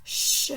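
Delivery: "ch" as a voiceless, sustained shush; isolated CH sound. Shown as a demonstration. Teacher revealing the repeated sound, "ch" as a long shush. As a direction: sound out "ch" alone, not as letter names. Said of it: "les lettres qui font le son ch".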